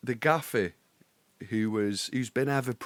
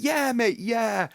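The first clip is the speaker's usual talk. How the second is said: strained high reply; 13 semitones higher, 4 dB louder, slower than usual. Retold or imitated. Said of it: "And I'll be like".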